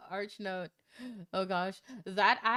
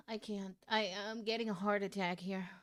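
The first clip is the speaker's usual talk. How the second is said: normal talking voice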